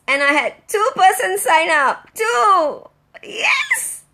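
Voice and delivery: real cries of joy, high and loud; shrieking with real excitement; overjoyed squeals. Real, not acted. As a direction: squeal with delight at the news. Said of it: "You know how excited".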